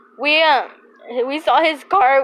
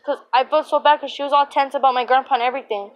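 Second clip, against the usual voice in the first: rushed, fast storytelling; talking fast; faster than usual. Real, not acted. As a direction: rattle it off quickly, fast. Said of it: "I'm sorry I'm talking fast".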